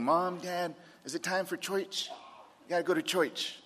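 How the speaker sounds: put-on high, soft kid voice, eagerly asking for church; little boy. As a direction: imitate a small boy pleading with his parents. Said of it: "my little boy cowboy boots".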